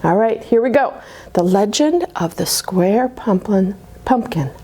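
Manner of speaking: usual speaking voice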